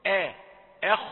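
"أخ" with thin front a, heavy kh; thin "آه", then the same vowel in "أخ"; light hamza. Shown as a demonstration. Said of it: "الهمزة رقيقة". (همزة "أخ" رقيقة وخاء مفخمة)

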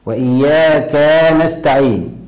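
وإياك ending in a long "kaa" before نستعين; extra alif after ك in وإياك; overlengthened fatha on kaf. Shown as a demonstration. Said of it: "أيضًا زود ألف".

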